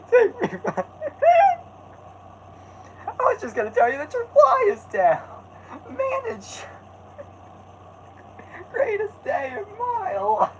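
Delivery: very high, fast, laughing cartoon squeal; squeaky cartoon voice.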